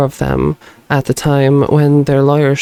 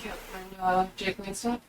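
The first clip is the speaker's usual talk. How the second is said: from another room; 4 semitones higher, 19 dB quieter than usual. Real, not acted. Raven talking from off mic.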